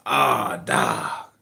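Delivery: imitated apologetic clerk. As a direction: let the bad news drop heavily. Retold clerk returns with bad news.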